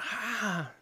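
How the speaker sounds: high-pitched mock scream, held back in volume; small, high imitation shriek; imitated scream. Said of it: "She's screaming, like".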